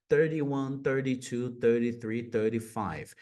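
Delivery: each THIRTY with a flapped T; American tapped T in THIRTY; American thirty numbers. Shown as a demonstration. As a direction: count with THIRTY as "thur-dee". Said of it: "os americanos falariam thirty".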